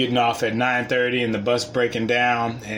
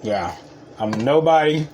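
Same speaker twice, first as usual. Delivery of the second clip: ordinary talk, nothing marked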